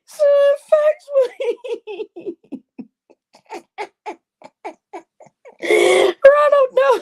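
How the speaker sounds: high, loud squeal through helpless laughter; losing it completely mid-sentence; laughing fit. Real, not acted. Telling off Ronald in chat, shrieking with laughter. Host loses it on stream.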